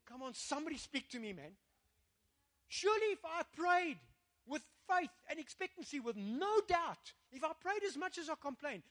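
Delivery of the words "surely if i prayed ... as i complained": high, emphatic pulpit delivery; fervent preacher's appeal; impassioned preaching. Real, not acted. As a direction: drive the conviction home with raised voice.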